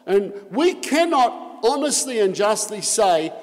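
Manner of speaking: loud emphatic sermon; forceful preaching. Real, not acted. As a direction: preach it hard from the pulpit.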